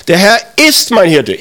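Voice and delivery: firm affirmation with emphasis on "ist"; stress on IST. Shown as a demonstration. Preacher voices a believer's confession, firm stress on "ist". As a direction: hit "ist" as a confession despite doubts. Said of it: "Aber du sagst".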